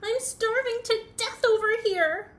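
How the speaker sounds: high, loud, whiny wail; whimpering complaint. Acted. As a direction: wail it with hammed-up misery. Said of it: "whimpered Bird".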